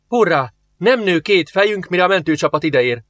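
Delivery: mock cheer, pitched high; lieutenant mocking the good news; sarcastic. A narrator's reading. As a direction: sneer through a fake hurrah. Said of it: "húzta el a száját Jenna".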